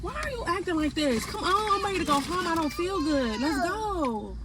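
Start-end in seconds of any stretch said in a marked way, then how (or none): none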